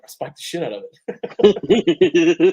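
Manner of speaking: slow, loud, drawn-out boast; proud brag. Retold or imitated. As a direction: savor every word like a proud brute. Retold Kittle bragging.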